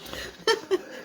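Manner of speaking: high breathy laugh. Real, not acted. Laughs at teasing.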